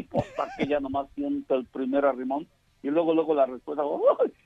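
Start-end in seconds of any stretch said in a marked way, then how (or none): 3.8-4.3 mock startled yelp. Wife's startled reaction voiced.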